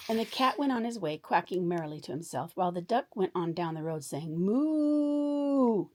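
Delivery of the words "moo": a high, louder moo. Acted. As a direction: moo out as loud as possible. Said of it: "just as loudly as possible".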